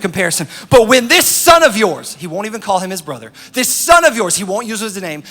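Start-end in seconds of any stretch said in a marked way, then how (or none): 0.7-2.2 loud, slow, pointed scorn; envious resentment. Acted. Brother won't name his sibling, loud slow scorn. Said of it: "He's full of envy". 3.5-4.3 loud, raised, pointed scorn; envious resentment. Acted. Brother won't use his name, loud scorn. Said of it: "He's full of envy".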